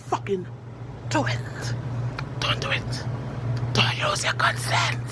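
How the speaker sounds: whispered warning. Real, not acted. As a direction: hush it as a secret caution. Warning the listener in a hush.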